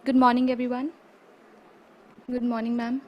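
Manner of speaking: ordinary talk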